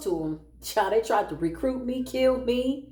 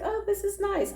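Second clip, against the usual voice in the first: fake delight; 12 semitones higher than usual. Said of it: "I'm like".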